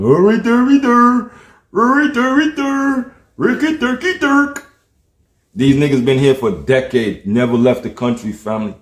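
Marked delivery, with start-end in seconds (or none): 0.0-4.7 high-pitched singsong nonsense jabber; mock them with silly high gibberish; mocking babble. Ranter mimicking clueless people, mocking high babble.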